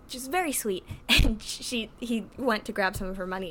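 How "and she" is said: ordinary talk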